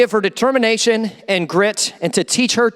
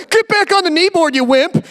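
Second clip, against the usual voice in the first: scolding wife; 6 semitones higher than usual. Acted. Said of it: "angry would you be at your wife".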